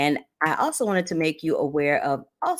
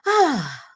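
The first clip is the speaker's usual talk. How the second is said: big relieved sigh after a long list; exhausted sigh; 12 semitones higher, 4 dB louder than usual. Real, not acted. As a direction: let out a long, tired breath. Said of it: "that was a lot to say".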